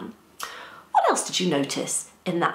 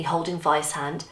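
ordinary delivery, nothing marked

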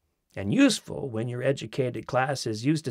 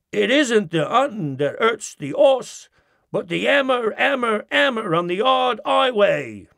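h-dropping Cockney, higher and louder; Cockney stable boy; 7 semitones higher, 3 dB louder than usual. Retold or imitated. Narrator mimics an English stable boy in Cockney. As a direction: drop every H like a London stable boy. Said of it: "when your stable boy says".

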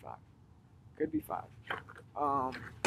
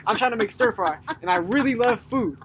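higher, louder, eager stranger full of pep; excited customer; 5 semitones higher, 4 dB louder than usual. Retold or imitated. Retelling the upbeat shopper at the store, eager and higher. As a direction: play the happy pepper shopper bubbling over. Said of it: "he was super enthusiastic".

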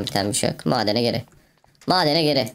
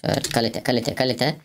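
ordinary talk, nothing marked

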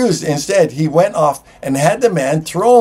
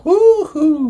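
a high, joyful woo-hoo, much higher than his speech; excited whoop; 3 dB louder than usual. Acted. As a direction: cheer like good news just arrived. Celebrating the cancelled debt.

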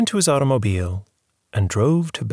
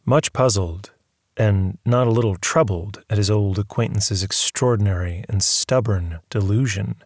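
ordinary delivery, nothing marked

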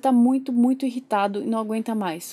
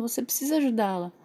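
ordinary talk, nothing marked

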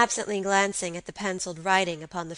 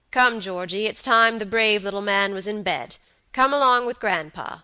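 louder, lightly voiced old man; coaxing grandfather; 2 semitones higher, 7 dB louder than usual. A narrator's reading. Grandpa sends little boy to bed, lightly coaxing. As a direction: coax a small child along.

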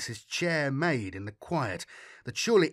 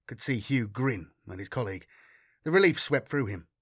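ordinary delivery, nothing marked

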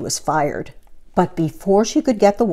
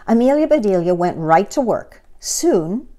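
ordinary delivery

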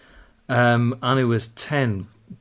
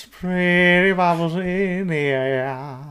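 slow, drawn-out Cockney crooning of the terrace song; Cockney singalong; 3 semitones higher, much slower than usual. Pretend. Fan showing off the song in a Cockney accent, slow and sung. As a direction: belt it like an East End football fan. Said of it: "fun song to sing in a Cockney accent".

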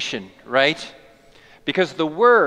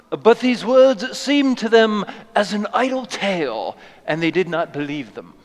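bad English accent; 2 semitones higher than usual. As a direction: read it like a bad British actor. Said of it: "with a very, very bad English accent".

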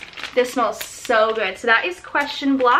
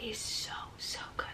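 whispering; 19 dB quieter than usual. Real, not acted. Savoring a scent, whispering.

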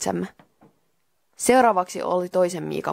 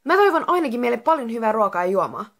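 eager higher-pitched outburst; sudden exclamation; 4 semitones higher than usual. Acted. Miika blurts a wish for food to the spirit, exclaiming. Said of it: "Miika huudahti yhtäkkiä".

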